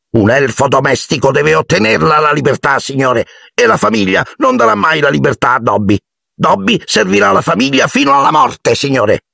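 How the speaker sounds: a servant too anxious to begin; fretful house-elf. A narrator's reading. Dobby resigned.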